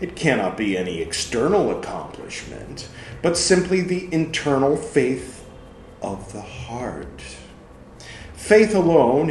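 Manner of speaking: loud, emphatic reading of Luther's words; forceful sermon reading. Acted. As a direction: argue it out from the pulpit. Reads Luther's text aloud.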